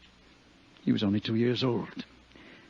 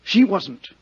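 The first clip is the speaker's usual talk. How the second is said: agitated pleading; 5 semitones higher, 5 dB louder than usual. Acted. Actor defends the girl against the detective.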